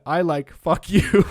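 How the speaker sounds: drawn-out quote broken by laughter; slow, laughing retort. Retold or imitated. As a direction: savour the comeback while giggling. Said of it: "And he said".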